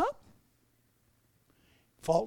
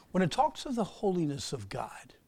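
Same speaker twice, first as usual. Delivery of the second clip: usual speaking voice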